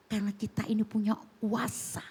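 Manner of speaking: ordinary talk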